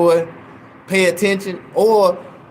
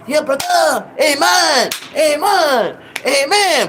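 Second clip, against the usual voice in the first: church congregation; 8 semitones higher, slower than usual. Retold or imitated. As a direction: chant it like a fired-up churchgoer. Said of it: "and they were like".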